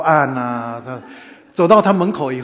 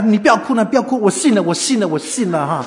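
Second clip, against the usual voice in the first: raised, loud hurried soothing, easing off; frantic placating; 5 semitones higher, 5 dB louder than usual. Retold or imitated. Host patting crying visitor, frantic placating. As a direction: say anything to stop the tears.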